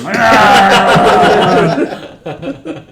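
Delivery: loud burst of laughter. Real, not acted. GM laughs at natural one.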